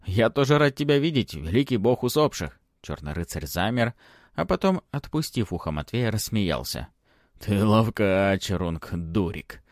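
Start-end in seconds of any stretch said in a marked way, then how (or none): 0.0-2.6 high, glad and eager; gladly. Acted. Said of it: "Обрадованно заговорил". 7.4-9.6 slow amused. Acted. God amused by Matvey.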